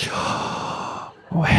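"Yahweh" as pure breath, inhaled and exhaled, no voice; breathed pronunciation. Shown as a demonstration. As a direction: breathe "Yahweh" slowly, one whispered breath per syllable. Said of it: "Let me take a deep breath".

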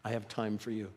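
tender promise. Retold or imitated. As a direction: gently reassure a dying man. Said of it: "Jesus says".

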